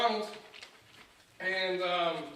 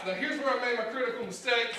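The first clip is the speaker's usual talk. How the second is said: ordinary talk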